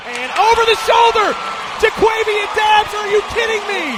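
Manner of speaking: high, loud amazed call; excited shout. Real, not acted. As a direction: scream the big catch. Deep catch called live.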